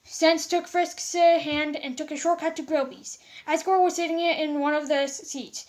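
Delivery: plain reading voice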